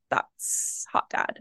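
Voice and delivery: slow, near-whispered retold reveal; hushed realization. Hairdresser's client realizing who the man is, hushed. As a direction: let it out slowly, barely voiced.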